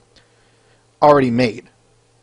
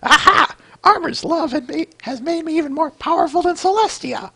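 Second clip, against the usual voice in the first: much higher, grand gloating villainess; high villain queen voice. Acted. Youtuber voicing a comic villain queen, high gloating. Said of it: "wrong, wrong voice".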